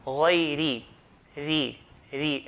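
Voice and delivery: ri said lightly with an empty mouth; غيري with a thin ra. Shown as a demonstration. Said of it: "Empty mouth".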